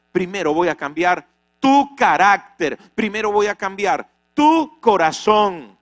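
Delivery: loud, commanding preaching of God's words; forceful divine voice. Acted. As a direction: thunder God's answer at Jacob. God speaking to Jacob. (voz de Dios, fuerte y autoritaria)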